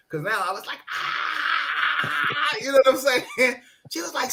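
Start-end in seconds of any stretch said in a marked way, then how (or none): 0.9-2.6 long, high wailing scream; imitated crazed yelling. Boy going crazy during whipping, long high yell. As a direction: hold a high scream like a kid gone crazy. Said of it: "I finally stopped yelling".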